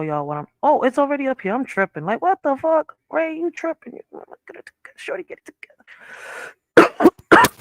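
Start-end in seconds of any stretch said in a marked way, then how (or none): 2.0-3.9 baffled at herself. Real, not acted. Host fumbling with screen, scolds self. 3.9-5.5 breathy whisper, telling herself off; whispered self-scolding. Real, not acted. Host fumbling with her screen whispers at herself. 6.8-7.5 squeaky end. Real, not acted. Host mutters to herself at screen.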